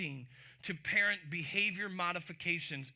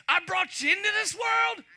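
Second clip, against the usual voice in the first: loud and much higher, hammed-up threat; folks' threat; 13 semitones higher, 11 dB louder than usual. Old-school parents to their kid, a loud mock threat. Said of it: "the way my folks said it".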